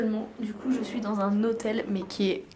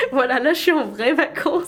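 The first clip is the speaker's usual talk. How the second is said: laughing; 3 semitones higher, 7 dB louder than usual. Real, not acted. Thrilled about the hotel.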